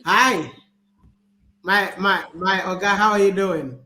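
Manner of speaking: usual speaking voice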